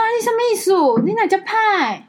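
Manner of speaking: mock indignant elder. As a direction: bristle like an offended relative. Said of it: "我说".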